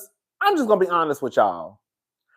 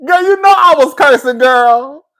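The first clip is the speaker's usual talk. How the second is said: much higher, much louder venting; exasperated yell; 8 semitones higher, 9 dB louder than usual. Real, not acted. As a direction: shout it to the viewers, fed up. Venting about the broken stream.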